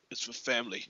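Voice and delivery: very low, hushed Dom Toretto impression. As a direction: drop deep and soft, imitating the action hero. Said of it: "he lowers his voice".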